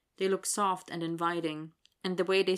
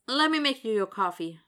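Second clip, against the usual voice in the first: higher, louder, hurried offer; in a rush; 4 semitones higher, 5 dB louder than usual. A narrator's reading. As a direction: blurt it out in a mild rush. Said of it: "Willie says in a rush".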